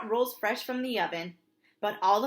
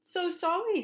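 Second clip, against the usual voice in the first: put-on toddler apology; 9 semitones higher than usual.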